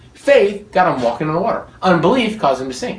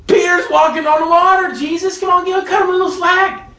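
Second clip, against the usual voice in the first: pitched way up, pleading for Peter; high mock protest; 10 semitones higher than usual. Preacher protesting to Jesus for Peter, high and pleading. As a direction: protest in a high voice, half laughing.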